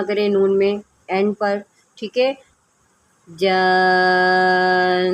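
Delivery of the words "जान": slow melodic Quran chanting; tajweed recitation. Shown as a demonstration. Teacher models the verse for online students, slow recitation. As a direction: stretch the vowels in measured recitation.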